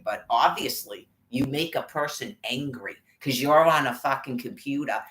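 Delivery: usual speaking voice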